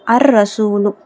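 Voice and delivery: "அர் ரசூலு" with no L and a rolled double R; silent lam. Shown as a demonstration. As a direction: merge the article into R in "அர் ரசூலு". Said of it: "லாம் உச்சரிக்கப்படலை". ("அர் ரசூலு" லாம் இல்லாமல், ர் அழுத்தி)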